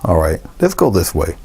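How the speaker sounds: ordinary talk, nothing marked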